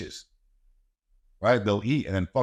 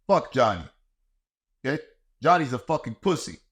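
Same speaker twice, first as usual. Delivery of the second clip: ordinary talk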